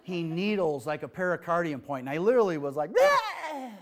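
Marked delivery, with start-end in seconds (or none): 3.0-3.7 deflating sigh. Retold or imitated. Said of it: "Just, like, deflating a balloon".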